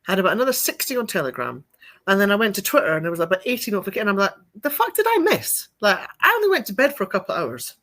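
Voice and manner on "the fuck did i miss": surprised. Retold or imitated. Shocked retold reaction.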